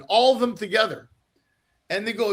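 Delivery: ordinary delivery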